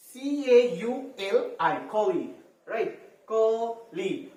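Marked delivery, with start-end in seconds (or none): 3.3-4.3 "কলি" stretched into two beats, kaw-lee; very slow "কলি", each syllable held apart; split into syllables. Shown as a demonstration. Teacher showing pupils two syllables, "কলি" drawn out syllable by syllable. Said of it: "দুটো syllable".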